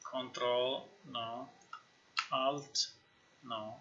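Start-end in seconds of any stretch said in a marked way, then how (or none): none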